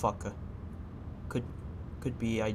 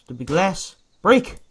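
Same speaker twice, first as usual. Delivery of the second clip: frustrated yell; 6 semitones higher, 7 dB louder than usual. Real, not acted. Straining to break the glass.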